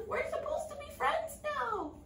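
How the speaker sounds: very high, plaintive frog; pleading frog. A narrator's reading. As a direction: call after her, hurt. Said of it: "called the frog".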